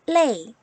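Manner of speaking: 레이 with an "ei" glide, loud; "ei" vowel sound. Shown as a demonstration. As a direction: model 레이 loudly for learners. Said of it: "에이 소리가 나네요".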